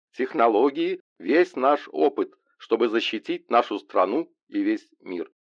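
rally the nation from the podium; presidential address. Acted.

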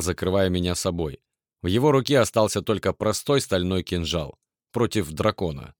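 usual speaking voice

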